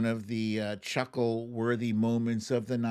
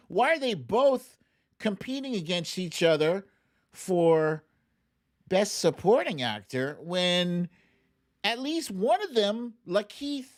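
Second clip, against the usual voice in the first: indignant disbelief; 8 semitones higher than usual. Real, not acted. Indignant about category choice.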